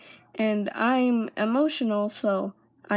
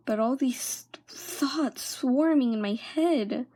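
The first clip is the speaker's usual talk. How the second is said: ordinary delivery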